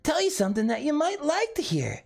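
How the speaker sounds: put-on very high, cutesy anime girl singing a lewd song; high anime girl voice, teasing the chat. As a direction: sing it in a squeaky anime-girl voice. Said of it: "do the whisper song in that voice".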